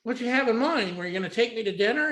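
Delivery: imitating a naive female caller; green girl caller. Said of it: "she was kinda green".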